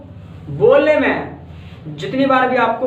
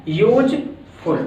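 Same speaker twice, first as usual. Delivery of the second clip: Useful split as "यूज - फुल"; broken into syllables; much slower than usual. Shown as a demonstration. Teacher showing how the word breaks, useful broken into syllables. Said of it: "word टूट रहा है".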